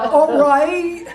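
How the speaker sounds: loud, high mock-exasperated husband; exaggerated defensive husband impression. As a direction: bellow it like a fed-up husband. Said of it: "we call it our husband voice".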